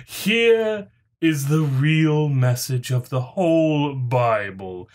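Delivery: ordinary talk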